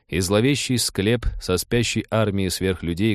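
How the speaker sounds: ordinary delivery, nothing marked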